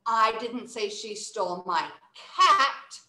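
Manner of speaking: slow build to a strong "cat"; stress on CAT. Shown as a demonstration. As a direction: lean into the final "cat". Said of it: "emphasize it one different way".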